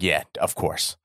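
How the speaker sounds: mock cool guy. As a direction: play it lazily self-satisfied.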